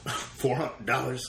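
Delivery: slow, laughing through the offer. Real, not acted. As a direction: hold back a laugh while naming the price. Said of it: "That's funny".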